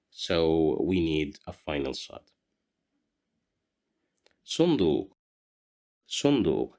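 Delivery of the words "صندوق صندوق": صندوق with a heavy emphatic s at the start; صندوق said clearly, opening with emphatic s; Arabic word said as a model. Shown as a demonstration. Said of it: "the missing letter at the beginning".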